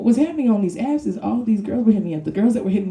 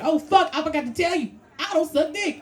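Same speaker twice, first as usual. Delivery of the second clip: much higher, alarmed yelp; mock shout; 11 semitones higher than usual. Comic realizing mid-date, high panicked mock shout. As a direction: blurt it out in panic. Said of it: "I'd be like".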